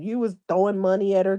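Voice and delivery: usual speaking voice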